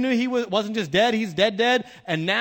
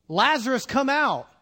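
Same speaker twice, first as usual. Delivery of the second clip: acted calling out, raised pitch; loud call; 5 semitones higher, 4 dB louder than usual. Jesus calls the dead Lazarus out of the tomb, in a loud voice. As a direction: call him out with moderate force. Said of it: "he called in a loud voice".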